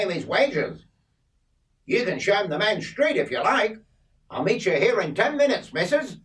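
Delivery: country farmer telling his wife he's off to the pub; voicing Bert. Bert's farewell to Kay.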